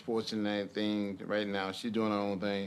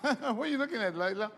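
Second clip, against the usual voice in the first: high, sing-song voice for toddlers; 14 semitones higher than usual. Real, not acted. Father talks to toddler daughters.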